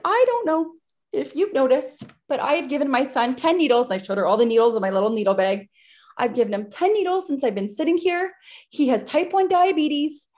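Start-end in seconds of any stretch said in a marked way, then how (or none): 0.0-3.9 higher-pitched, upset retelling; imitating an upset mother, raised pitch; retold tearful outburst. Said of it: "I started bawling". 6.2-10.3 high-pitched, upset insistence; raised pitch, re-enacting distress; retold tearful outburst. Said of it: "I started bawling".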